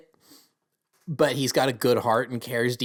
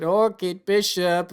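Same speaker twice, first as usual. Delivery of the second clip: high, drawn-out mock Russian accent; terrible Russian accent; 7 semitones higher, 5 dB louder, slower than usual. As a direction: do a badly faked Russian accent, loud and slow. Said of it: "that's a terrible Russian accent".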